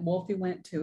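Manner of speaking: ordinary delivery, nothing marked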